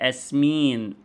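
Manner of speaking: أسمين slowly, starting on the vowel; child's slow أسمين, vowel-initial; y dropped. Shown as a demonstration. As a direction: say أسمين with the opening y missing. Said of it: "إبدال الياء أو ترحيلها".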